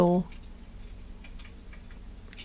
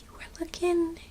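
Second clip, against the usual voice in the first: whispering; 6 semitones higher, 8 dB quieter than usual. Real, not acted. Whispering to the ladybug.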